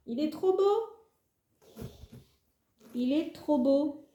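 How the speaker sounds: high, slow, drawn-out gush over the plant; gushing delight. Real, not acted. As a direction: savour each word in delight. Admires the unboxed plant.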